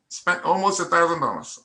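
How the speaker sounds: ordinary delivery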